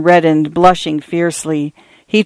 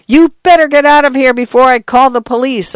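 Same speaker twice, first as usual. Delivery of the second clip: high, agitated warning shout; restrained narrator's yell, high and urgent; shrill yell; 7 semitones higher, 3 dB louder than usual. A narrator's reading. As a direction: yell a threat after someone fleeing, pitched up high. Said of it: "Arthur yelled. His voice was shrill".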